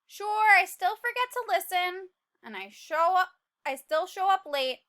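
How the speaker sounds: lively, upbeat read-aloud; cheerful narration. A narrator's reading. Seed listing his good habits to the class, cheerful narration. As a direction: read it bright and hopeful.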